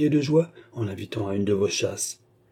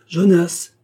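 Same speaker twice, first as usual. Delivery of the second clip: second call, much louder and higher; 8 semitones higher, 8 dB louder than usual. A narrator's reading. Baroness calling Jonas again from the next room, loud shout. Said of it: "appela-t-elle".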